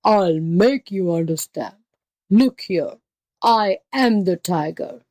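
slow, emphatic angry beast; enraged tiger. A narrator's reading. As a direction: spell it out as an exasperated tiger. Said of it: "in a rage at the jackal's stupidity".

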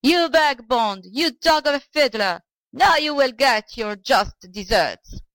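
put-on angry old man calling out abuse; crying after him. A narrator's reading. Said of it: "the old man cried after him".

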